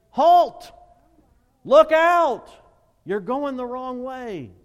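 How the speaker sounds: full-force high shout of alarm; shouted warning. Acted. Preacher voicing God stopping someone going wrong, shouted warning. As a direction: yell an urgent warning, drawing out the last phrase. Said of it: "He brings things into our life to say".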